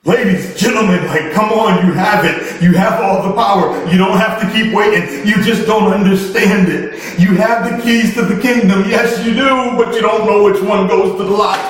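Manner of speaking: preaching at full force. Acted. Motivational speaker urging his audience to claim their power, preaching hard. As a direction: preach it to the congregation with mounting fire.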